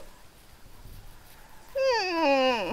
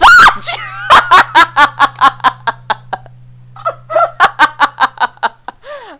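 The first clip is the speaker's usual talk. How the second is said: 1 loud shrieking laugh; 13 dB louder than usual. Real, not acted. Goblin jump scare.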